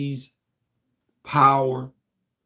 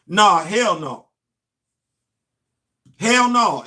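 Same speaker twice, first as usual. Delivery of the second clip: emphatic refusal; 3 semitones higher, 5 dB louder, much slower than usual. Real, not acted. Rejects Bucky as Hunter.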